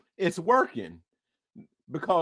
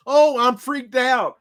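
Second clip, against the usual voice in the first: louder, slow hammed-up fear; mock fright; 6 semitones higher, 5 dB louder, slower than usual. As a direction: play them spooked. Scared people voiced.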